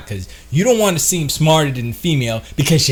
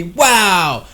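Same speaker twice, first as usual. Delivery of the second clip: eager flirt; 6 semitones higher than usual. Shown as a demonstration. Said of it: "Then you say".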